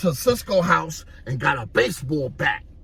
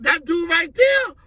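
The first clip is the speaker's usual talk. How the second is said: shouted question; 4 dB louder than usual. Retold or imitated. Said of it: "And he like".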